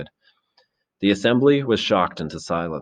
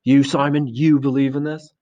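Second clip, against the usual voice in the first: high, louder incredulous question; amazed; 8 semitones higher, 5 dB louder than usual. A narrator's reading. Ralph questioning Simon after his beast remark, amazed. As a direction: challenge him in sheer disbelief. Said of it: "Ralph stood up in amazement".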